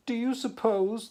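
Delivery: walrus voice. Acted. Walrus voiced in read poem.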